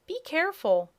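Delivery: high, loud squealing piglet. A narrator's reading. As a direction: squeal it like a tiny pig. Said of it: "said the piglet with a squeal".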